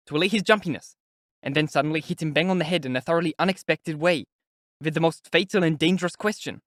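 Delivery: chatty investigator explaining his tricks, lightly voiced; lightly voiced sly interrogator. A narrator's reading. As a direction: relish each step of the trick. Porfiry speaking within the story.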